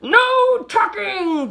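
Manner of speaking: scandalised pirate chorus; crew cry. A narrator's reading. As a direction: cry it out in indignation. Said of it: "the crew cried".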